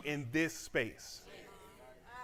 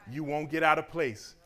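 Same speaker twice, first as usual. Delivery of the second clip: ordinary talk, nothing marked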